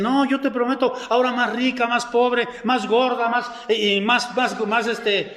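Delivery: hammed-up homemade promise; mock wedding vows. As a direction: playfully pledge yourself like a newlywed. Mimics people making homemade wedding vows.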